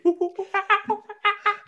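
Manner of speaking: high-pitched hooting monkey call; monkey noises. Pretend. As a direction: hoot like an excited chimp. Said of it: "great impression of a monkey".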